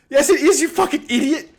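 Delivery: high, loud mock-furious yell; laughing angry yell. Player yelling at Dendar over the money, furious. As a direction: scream the insult half laughing.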